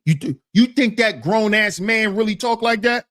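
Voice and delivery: ordinary talk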